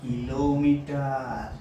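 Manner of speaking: 2 drawn out very slowly for pupils to repeat; 3 say kilometre syllable by syllable, very slowly; 1 slow dictation. Shown as a demonstration. 4 teacher reading kilometre aloud for pupils to copy, very slow. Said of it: "উচ্চারণ করে শোনাবো".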